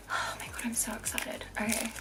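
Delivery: whispered, breathless with excitement; excited whisper. Real, not acted. About to open the soap, whispering her excitement. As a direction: hush it like a thrilling secret.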